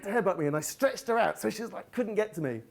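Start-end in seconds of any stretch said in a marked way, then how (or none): none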